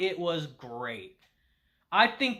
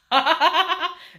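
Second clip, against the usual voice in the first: more laughter; 11 semitones higher, 7 dB louder than usual. Real, not acted. Laughing again.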